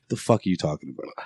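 low, quick, quiet challenge; dead serious. Retold or imitated. Sean answering an out-of-towner about Brady, dead serious. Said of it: "Dead serious".

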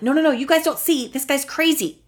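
quick, high-pitched protest; frantic disbelieved character. Acted. Host as the one gaslit movie character, frantic protest. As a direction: plead fast and high that nobody sees it. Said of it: "It's one character being like".

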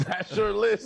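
laughing through the words. Real, not acted. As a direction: repeat it with a laugh.

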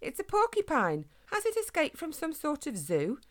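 high-pitched excited lady; delighted squeal. A narrator's reading. Lady mistakes the hedgehog for a porcupine, squealing delightedly. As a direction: keep it high and thrilled. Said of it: "she squealed in delight".

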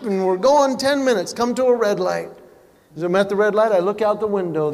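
normal talking voice

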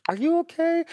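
high, caring voice of a star; high concerned voice. Retold or imitated. [name] checking on her crying fan, high concerned voice. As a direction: ask gently, way up high, full of concern.

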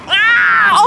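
high, loud comic yelp of alarm; a sudden cartoon shriek at the fire; panicked yelp. Boat on fire.

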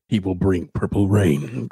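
slow whispered epic trailer voice; breathy dramatic trailer read; movie trailer voice. Acted. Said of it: "epic movie trailer voice going there".

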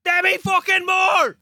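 high, drawn-out frustrated yell; exasperated shout. Real, not acted. Player is losing his match, exasperated shout.

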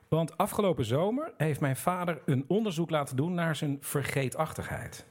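normal talking voice

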